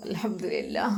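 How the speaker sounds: put-on breathy whisper of an old woman; whispered thanks. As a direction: murmur it under your breath. Grandmother murmurs thanks.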